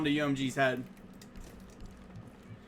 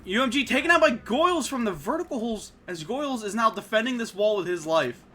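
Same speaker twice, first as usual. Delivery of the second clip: loud, higher esports call; excited play-by-play; 4 semitones higher, 5 dB louder than usual. Real, not acted. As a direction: hype it up as the play unfolds. Caster calls a big play.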